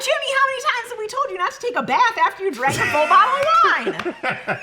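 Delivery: high, scolding mom voice; scolding parent voice. Acted. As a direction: talk down to Jimmy, high and scolding. Mock scolding Jimmy in a role.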